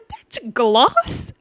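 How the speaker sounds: high-pitched disbelief, rising questions; incredulous. Real, not acted. Reacting to Gloss being picked, incredulous. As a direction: balk at the choice, voice shooting up.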